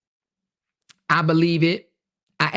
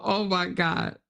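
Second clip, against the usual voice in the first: ordinary talk, nothing marked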